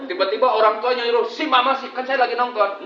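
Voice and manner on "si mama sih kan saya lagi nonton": whiny, loud complaining husband; mock nagging husband. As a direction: whine at your wife from the couch. Mocking a bickering husband.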